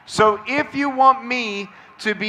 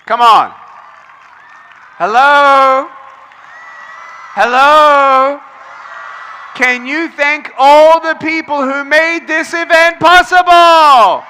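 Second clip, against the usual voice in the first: loud, higher hype call; crowd-rousing shout; 5 semitones higher, 8 dB louder than usual. Real, not acted. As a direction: whip the crowd into cheering. Urging crowd to applaud.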